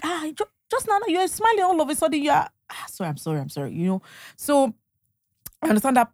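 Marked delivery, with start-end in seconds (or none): 0.0-2.8 high, mocking retort, half teasing; mock accusing. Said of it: "you'll be like". 2.9-3.7 soft, lower, sheepish sorry; meek apology. Retold or imitated. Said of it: "you'll be like".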